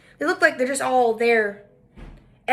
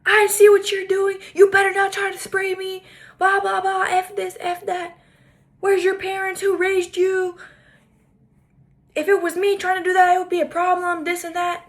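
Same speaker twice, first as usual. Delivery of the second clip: mock angry rant, pitched higher and louder; imitating her yelling; 7 semitones higher, 3 dB louder than usual. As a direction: ham up a grown woman screaming at a kid. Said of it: "She starts screaming at this kid".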